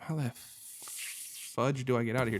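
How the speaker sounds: ordinary talk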